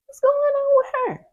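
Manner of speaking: high, squeaky put-on voice; a shrill gossiping onlooker; mock puzzled gossip. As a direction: ask it in a high nosy voice.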